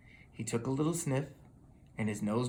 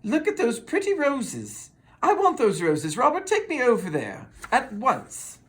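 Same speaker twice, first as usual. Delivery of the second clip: high, loud bossy woman; spoiled rider demanding; demanding lady; 7 semitones higher, 4 dB louder than usual. Acted. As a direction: insist on having them.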